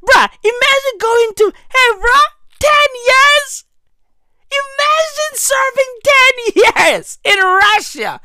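very high squealing disbelief; incredulous outburst. Real, not acted. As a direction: shoot way up high in outraged disbelief. Incredulous rant on ten years.

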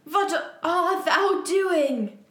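high, slow theatrical villain call; Voldemort calling out. Acted. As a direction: call out grandly, stretching the words. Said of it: "called Voldemort".

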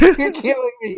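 high-pitched mock wail breaking into laughter; hammed-up anguish through a laugh; Yato in agony. Yato dying, crying out to Yukine, mock anguish.

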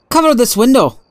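high, urgent order; trace of emotion. A narrator's reading. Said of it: "a trace of emotion in his voice".

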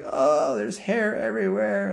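high-pitched mock disgust; acted yucky-mouth whine; grossed out. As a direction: play kids recoiling at the taste. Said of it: "tastes kinda nasty".

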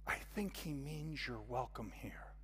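soft whispered aside; confiding whisper. Acted. Hostess leans over to reassure newcomer, whispering. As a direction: lean in and whisper a gentle explanation. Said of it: "the hostess leans over and says".

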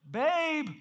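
loud, high attention-getting call. Acted. Husband trying to reach his wife through her AirPods, calling loudly. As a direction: call out to someone who can't hear you. Louder calls to unhearing wife.

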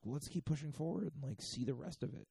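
high, chipper retold suggestion; mock eagerness. Hikers urging each other on, mock eagerness. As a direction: lift the pitch like a keen hiker.

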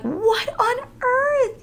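high, slow, drawn-out disbelief; astonished. Retold or imitated. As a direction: stretch it out in shocked disbelief. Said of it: "And I'm like".

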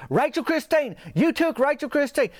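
high, shrill-pitched accusing yell; outraged yell. Retold or imitated. Meemaw shouting at Brett over her doll. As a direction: scream the accusation.

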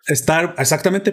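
ordinary talk, nothing marked

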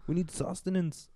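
high, slow put-on character voice; Danny DeVito as Pikachu. Riffing as Pikachu asking for food, high slow character voice. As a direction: plead slowly in a high cartoon voice. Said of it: "couldn't picture his voice as Pikachu".

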